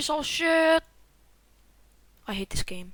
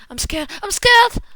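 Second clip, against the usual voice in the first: loud, high, climbing panic; scared cry, rising sharply; panicked shout; 6 semitones higher, 10 dB louder than usual. Real, not acted. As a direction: build to a frightened yell.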